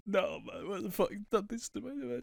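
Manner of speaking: ugly-cry through a laugh; laughing mock sob. Rewatching a soap death, laughing mock crying.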